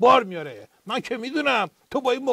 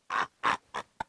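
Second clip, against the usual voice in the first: breathy chuckle; 9 semitones lower, 3 dB quieter than usual. Acted. Host laughs at joke.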